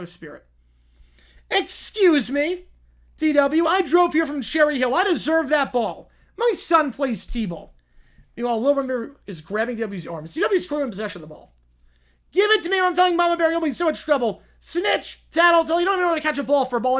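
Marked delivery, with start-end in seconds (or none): 1.5-7.7 a hint of a demanding Karen, higher; the entitled mom who wants the manager. Karen demanding the ball from a kid, lightly voiced and pushy. 12.3-17.0 high, loud, fast tattling brat; bratty kid threat. Acted. Kid threatening to tell Mama Bear, high and fast. Kids squabble over ball.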